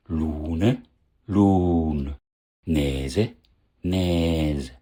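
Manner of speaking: each full form with final e, then Dorm, Hüs, Lun, Näs with very stretched vowel; overlong vowels. Shown as a demonstration. Teacher shows how overlength arises, stretched-vowel demo. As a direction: drop the final e and draw out the vowel, voiced ending, as Näse to Näs. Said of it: "Endsilbe mit E weggefallen".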